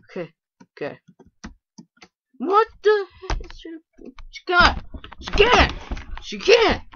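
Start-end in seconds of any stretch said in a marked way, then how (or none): 2.4-3.5 high, loud outburst of disbelief; high disbelieving yelp; shocked. Real, not acted. Shocked at another player. 3.5-6.8 high, loud outraged protest; high outraged repeats. Real, not acted. Repeated outrage at other player.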